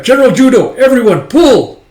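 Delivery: high, very loud rallying yell; shouted command. Acted. Soldiers roping a dragon down, shouted command. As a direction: yell to a team hauling on ropes.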